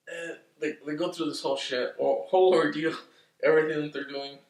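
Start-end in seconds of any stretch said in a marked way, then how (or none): none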